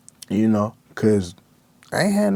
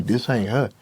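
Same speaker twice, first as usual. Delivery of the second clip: normal talking voice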